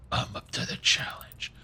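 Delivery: hushed, slow Batman whisper. Acted. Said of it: "I say it like Batman".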